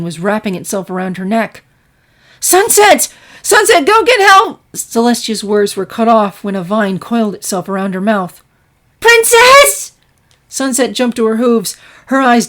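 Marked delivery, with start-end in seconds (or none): 2.4-4.6 high, loud panicked call; desperate cry for help. Acted. Princess caught in the lake yells to her student, high panicked shout. Celestia calls out while being dragged. 9.0-9.9 high loud frightened call; alarmed cry. Acted. Filly sees her teacher being attacked, high frightened shout.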